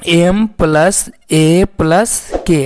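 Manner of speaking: very slow, each letter of एम plus ए plus के pulled apart; slow letter spelling. Shown as a demonstration. Teacher to students, spelling slowly letter by letter. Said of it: "मतलब".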